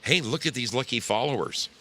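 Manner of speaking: ordinary talk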